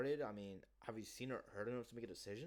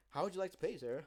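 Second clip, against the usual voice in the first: salesman pitch; 8 semitones higher, 7 dB louder than usual. Retold or imitated. Salesman voicing the close.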